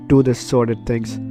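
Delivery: usual speaking voice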